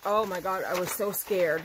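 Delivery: ordinary delivery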